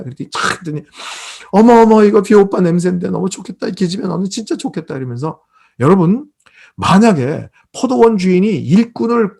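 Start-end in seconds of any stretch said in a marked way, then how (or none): 1.5-4.9 imitated girlish envy, high and loud at first; envious gushing. Girl smelling the idol's scent on her friend, envious gushing. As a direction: gush over a friend's luck, then ease off.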